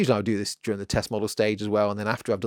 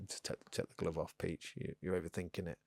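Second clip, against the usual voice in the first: much softer, low self-scolding; muttered self-talk; 4 semitones lower, 16 dB quieter than usual. Real, not acted. Sweaty costumed painter scolding himself, in a soft mutter. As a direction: tell yourself off under your breath.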